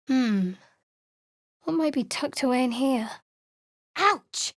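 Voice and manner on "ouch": high sudden cry of pain; sharp pain yelp. Acted. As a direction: yelp as if struck.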